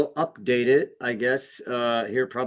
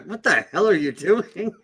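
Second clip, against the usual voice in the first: lightly voiced exasperated senior, higher pitched; higher, mildly indignant quote; imitated senior; 7 semitones higher than usual. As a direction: mimic a baffled onlooker, pitch raised. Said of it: "I can hear my senior basically going".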